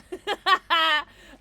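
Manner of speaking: high, loud burst of laughter; big high cackling laugh. Real, not acted. Laughs at own French exchange.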